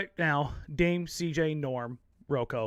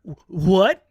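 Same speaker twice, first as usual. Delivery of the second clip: loud, rising disbelief; mock stunned; 3 semitones higher, 10 dB louder than usual. Vegetarian learns the candy is pig, mock stunned. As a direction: stammer it out, louder, imitating shock. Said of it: "He's like".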